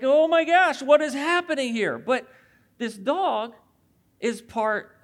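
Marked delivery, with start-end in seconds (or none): 0.0-2.0 higher, louder startled gasp of a parent; sudden mock panic as the dog nips; retold alarm. Pastor retelling her shock at the dog nipping the kids, higher and louder. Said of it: "And I was like".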